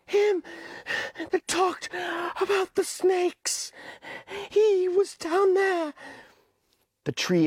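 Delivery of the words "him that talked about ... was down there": put-on high, pleading boy voice; high-pitched anxious boy Piggy; Piggy's voice. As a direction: voice the fretful schoolboy Piggy, pitched well up. Piggy recalls.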